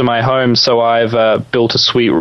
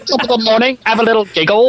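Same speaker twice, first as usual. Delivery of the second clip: hammed-up Irish; 9 semitones higher than usual. Mocking Australian phrases.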